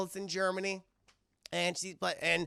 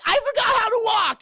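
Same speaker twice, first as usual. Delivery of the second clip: mock ditzy; 14 semitones higher, 10 dB louder than usual. [name] before falling.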